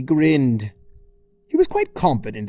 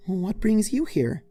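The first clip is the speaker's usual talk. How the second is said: gentle Fluttershy; 8 semitones higher than usual. Acted. Fluttershy asking Twilight why she came, gentle and sweet. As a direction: ask kindly, lifted voice.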